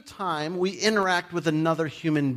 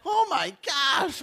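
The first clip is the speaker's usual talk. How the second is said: mock shock; 11 semitones higher, slower than usual. Mock outrage at own idea.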